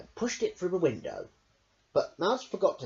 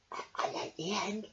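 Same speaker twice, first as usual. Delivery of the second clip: softer, hesitant quiet voice; timid whisper; 5 semitones higher, 7 dB quieter than usual. Acted. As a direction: shyly admit it. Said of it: "Hedgehog whispered".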